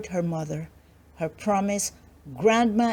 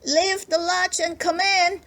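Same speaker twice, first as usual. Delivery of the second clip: much higher granny voice; Grandma lets the visitor in, high and old; 10 semitones higher than usual. Acted. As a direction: welcome her in as a trusting granny. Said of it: "said Grandma".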